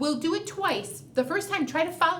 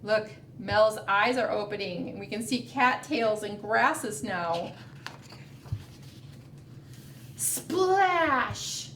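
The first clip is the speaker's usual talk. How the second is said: put-on reading to children; slower than usual. A narrator's reading. Reads picture book aloud.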